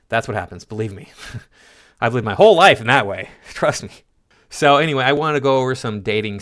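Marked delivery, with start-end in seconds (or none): 0.1-3.9 chuckling between phrases, swelling louder midway; amused, mock-earnest insistence; laughing sarcasm. Real, not acted. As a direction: say it through ironic laughter.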